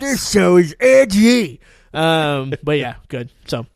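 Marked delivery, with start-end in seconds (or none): 0.0-1.6 mock shout. Joking shouted verdict.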